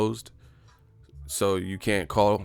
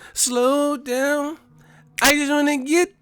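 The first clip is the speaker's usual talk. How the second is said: very high, louder coy put-on voice; high mock flirt; 8 dB louder, slower than usual. Date telling the eager suitor to slow down, coy and high. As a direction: play a coy date pushing back.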